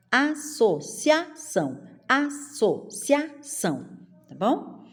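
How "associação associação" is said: "Associação" slowly as a-so-CIA-ção; even syllables, cia in one beat; joined vowels. Shown as a demonstration. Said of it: "fica tudo junto".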